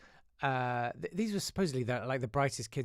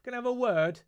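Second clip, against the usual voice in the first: high, loud put-on summons; have-a-word manner; 10 semitones higher, 7 dB louder than usual. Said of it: "in that sort of".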